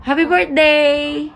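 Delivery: high, drawn-out happy cheer; excited birthday greeting. Real, not acted. Mother greeting her child on the birthday, excited cheer. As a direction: sing out the greeting, long and high.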